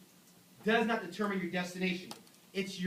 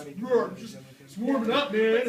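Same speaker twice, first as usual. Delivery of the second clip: gym guy; 3 semitones higher, 8 dB louder, slower than usual. Retold or imitated.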